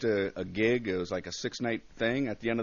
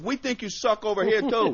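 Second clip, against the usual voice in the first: high, raised heckler's call; imitated heckler; 10 semitones higher, 5 dB louder than usual. Second heckler chimes in from the other side, calling out. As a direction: call it across the bar. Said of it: "another guy from the other side goes".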